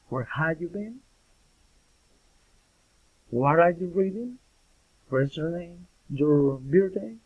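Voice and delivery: questions climbing high on "been", "reading", "name" and "birthday"; rising intonation. Shown as a demonstration. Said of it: "rising, intonation".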